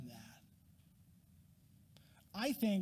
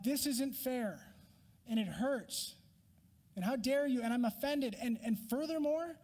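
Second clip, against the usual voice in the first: whiny complaint; 5 semitones higher than usual. Pretend. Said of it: "There's a real whine in that tone".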